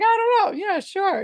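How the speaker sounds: high, playful imitated reply; joking quote. Medium voicing his joking answer to her, high and playful. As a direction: toss it off in a high pretend voice.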